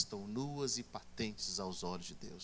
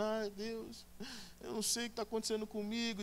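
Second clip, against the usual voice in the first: mock crying; 7 semitones higher, 5 dB quieter than usual. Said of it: "você chega chorando".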